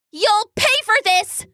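high-pitched, indignant little yell; angry threat. A narrator's reading. Weasel storming out after her brother, angrily threatening.

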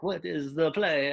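confused teammate. Retold or imitated. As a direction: play the lost teammate asking, slowly. Teammate asking about the play.

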